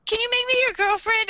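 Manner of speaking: very high, loud pleading squeal; hammed-up girlish falsetto; cutesy girl. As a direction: imitate an eager kawaii girl. Acting Misa's cutesy request.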